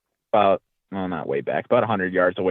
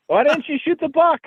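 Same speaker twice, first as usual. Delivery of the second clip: much higher, pleading question; incredulous kid. Retold or imitated. Boy asking grandfather why he passed on the buck, much higher. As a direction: replay the boy's high-pitched protest.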